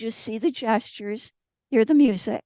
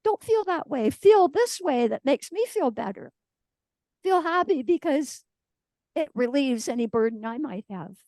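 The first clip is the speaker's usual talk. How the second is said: much higher, insistent mock cheerfulness; mock pushy cheering; 4 semitones higher than usual. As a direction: parody someone forcing you to feel better. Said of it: "yanking them up and saying".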